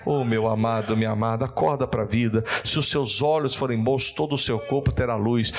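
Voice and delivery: ordinary delivery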